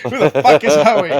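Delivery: high and loud through laughter; laughing disbelief. Real, not acted. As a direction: laugh out the question.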